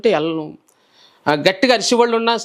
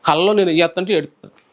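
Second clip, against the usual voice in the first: usual speaking voice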